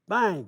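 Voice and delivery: loud sudden bang; loud mock explosion; imitated bang. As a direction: blurt out the explosion.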